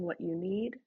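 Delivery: usual speaking voice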